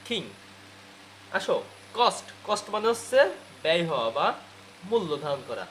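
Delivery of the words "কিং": কিং with a puff on K, "khing"; aspirated K. Shown as a demonstration. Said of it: "কি বলতে হবে?". (কিং, খ দিয়ে খিং)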